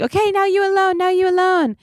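high-pitched mock press call-out; imitated photographer shouting. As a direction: call out orders like red-carpet photographers. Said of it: "there's just shouting".

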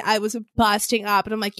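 normal talking voice

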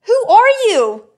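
outraged disbelief at the stranger's pity remark; incredulous outrage. Real, not acted. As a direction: demand it in loud disbelief.

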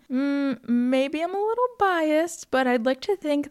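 coy self-praise, stretched slowly; playful smugness. Real, not acted. Playfully bragging about own advice.

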